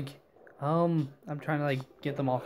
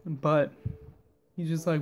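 ordinary talk

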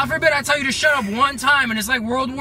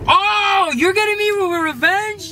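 surprised outcry; 6 semitones higher, much slower than usual. Real, not acted. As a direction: realize you've been pranked. Prank revealed to boyfriend.

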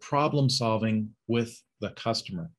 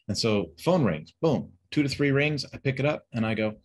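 ordinary delivery

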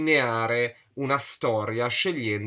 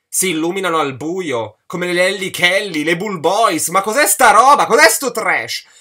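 loud, high outburst of disbelief; escalating comic outrage, louder and higher; indignant rant; 5 semitones higher, 11 dB louder than usual. Real, not acted. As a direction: shout in exasperation at the nonsense. Indignant rant at absurd book detail.

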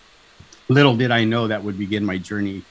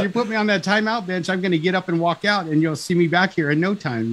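much higher, laughing challenge to the judge; cheeky defiance; 8 semitones higher than usual. Retold or imitated. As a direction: dare the judge, half laughing.

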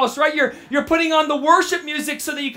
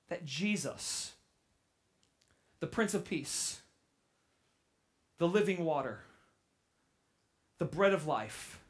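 much softer and lower, intimate; soft reflective preaching; 8 semitones lower, 12 dB quieter than usual. Acted. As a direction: draw the congregation in quietly.

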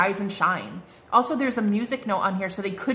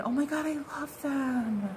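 normal talking voice

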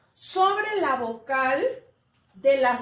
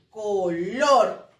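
"Color" slow and lower, force on LOR; stress on last syllable; 6 semitones lower, 4 dB louder than usual. Shown as a demonstration. Teacher proving the última sílaba is strongest, last syllable stressed. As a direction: stretch "Color" and lean hard on the final LOR. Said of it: "la última sílaba es la que tiene más fuerza".

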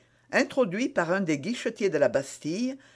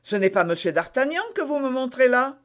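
higher-pitched astonished objection; exclamation étonnée; 9 semitones higher, 4 dB louder than usual. A narrator's reading. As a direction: object in surprise. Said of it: "s'écria Bonacieux". (s'étonner d'une voix plus aiguë)

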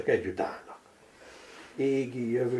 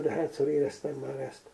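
ordinary delivery

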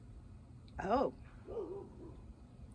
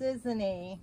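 ordinary delivery, nothing marked

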